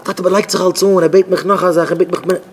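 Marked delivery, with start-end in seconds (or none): none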